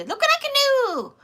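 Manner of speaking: squeaky high mock voice. Voicing the dog showing off, squeaky high. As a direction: pipe it up high like a proud pet.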